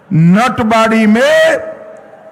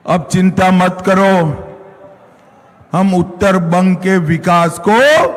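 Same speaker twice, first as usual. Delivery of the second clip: normal talking voice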